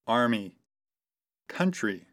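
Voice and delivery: usual speaking voice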